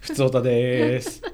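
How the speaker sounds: loud drawn-out announcer call; radio segment call. Acted. (元気なラジオの呼び込み)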